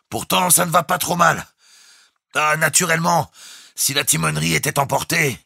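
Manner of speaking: high, loud voice on a held pitch; calling out. A narrator's reading. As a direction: keep calling down the speaking tube. Captain continues through speaking tube. (propos lancés d'une voix forte)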